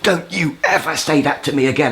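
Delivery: mild mock shout of fury; retold angry shout. Enraged man rounding on his tormentor, retold angry shout. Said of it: "And I just shouted".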